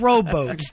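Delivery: ROWBOATS said as "row-boats", for robots; imitated mispronunciation. Host teasing his partner, imitated mispronunciation. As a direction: turn robots into ROWBOATS with a long "oh". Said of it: "the way you say it sounds like a rowboat".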